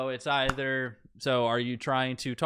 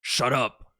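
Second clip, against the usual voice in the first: lightly voiced knight, louder command; knight silencing a captive; 3 semitones higher, 7 dB louder than usual. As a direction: raise the voice a little, as a knight. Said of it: "and the knight's".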